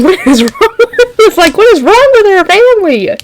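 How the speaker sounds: high bewildered whine; incredulous. Retold or imitated. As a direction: squeal it out baffled. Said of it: "And I was thinking".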